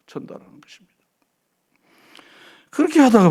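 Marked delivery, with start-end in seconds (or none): 0.1-0.9 "전달하는 것입니다" repeated in a soft, unvoiced whisper; whispered echo. Shown as a demonstration. Preacher echoing the point in a whisper to the congregation. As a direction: drop "전달하는 것입니다" to a breath. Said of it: "그냥 전달하는 것입니다".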